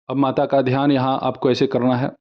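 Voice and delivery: usual speaking voice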